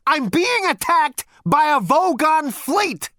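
high, loud, drawn-out outburst; desperate cry. A narrator's reading. Zaphod finally gets his emergency across, crying out loudly. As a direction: cry out to break through. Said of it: "cried Zaphod".

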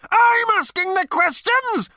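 very high, loud, apoplectic howl; furious howl. A narrator's reading. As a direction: howl it in quaking fury. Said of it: "howled number two".

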